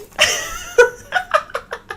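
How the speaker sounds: high-pitched burst of giggles; high, giddy laughter; real laugh. Real, not acted. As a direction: crack up at your own bit. Laughs at own mock excitement.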